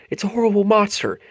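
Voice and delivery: high, loud and fast in panic; terrified cry. A narrator's reading. Muck panicking at the horrible monster. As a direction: blurt it out in panic. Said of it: "Muck screamed".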